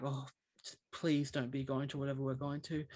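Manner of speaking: quietly dreading it to a mate; hushed plea. Retold or imitated. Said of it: "we're like".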